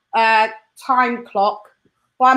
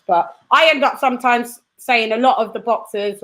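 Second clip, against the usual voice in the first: ordinary talk, nothing marked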